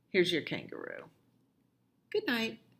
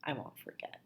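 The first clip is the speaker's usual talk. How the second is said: whisper; 5 semitones lower, 13 dB quieter than usual. Acted. Parent whispers promise.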